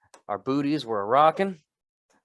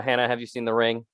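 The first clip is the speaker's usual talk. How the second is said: ordinary delivery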